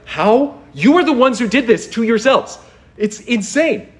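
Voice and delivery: high-pitched, emphatic outburst; indignant and incredulous; exasperated disbelief. Real, not acted. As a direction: throw the blame back, baffled. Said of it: "I hope you feel what I'm feeling".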